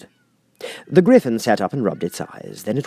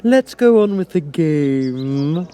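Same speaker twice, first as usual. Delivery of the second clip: high, slow queenly command; imperious queen; 6 semitones higher, 4 dB louder, much slower than usual. A narrator's reading. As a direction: order her back to the game. The Queen speaks to Alice.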